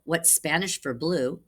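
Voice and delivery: ordinary delivery, nothing marked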